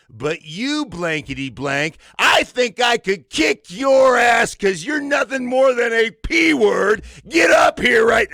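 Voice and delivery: loud, high yelled challenge; furious shouting. Retold or imitated. Coach calling out a player before the team, shouting. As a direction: rage at him in front of everyone. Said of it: "he goes".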